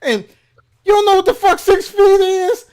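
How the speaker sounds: high, loud raging yell; cursing out. Retold or imitated. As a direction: tear into them at full volume. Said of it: "I cursed both of those motherfuckers out".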